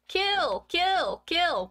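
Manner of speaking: high shrill screech of a dummy, loud; high shrill dummy scream. Acted. Boy with dummy screams at sleeping brother, high shrill shriek. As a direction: shriek each kill like a killer puppet. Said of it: "shrieking at him".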